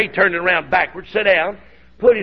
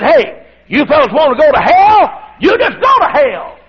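shouted, much higher and louder, falling hard on hell; angry preacher shout; 8 semitones higher, 6 dB louder than usual. Retold or imitated. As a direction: bark the warning at the gamblers like a riled-up preacher. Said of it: "I bowed up and I said".

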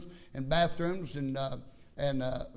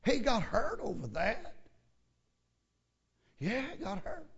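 mock disbelief; 5 semitones higher than usual. Real, not acted. Mocking the deacon's hurt feelings.

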